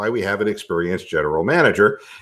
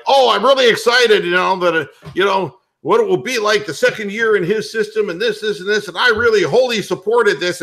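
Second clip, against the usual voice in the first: higher-pitched gushing coach impression, lightly done; mock excited coach; 9 semitones higher, 4 dB louder than usual. Host mimicking the Green Bay coach about Pettine, mock excited. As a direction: lightly parody an eager coach's press comment. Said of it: "And it's like".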